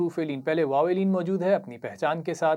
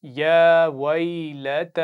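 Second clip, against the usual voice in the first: drawn-out, melodic tajweed recitation; slow Quran recitation; 7 dB louder, much slower than usual. Shown as a demonstration. Teacher reciting slowly for pupils to repeat. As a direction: chant each syllable slowly for the class to copy. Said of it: "आप गौर से सुनिए और दोहराइए".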